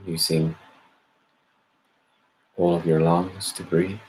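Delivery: calm, measured meditation guidance with long pauses; guided meditation. Acted. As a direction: gently lead listeners inward, leaving space after each phrase. Leads a meditation.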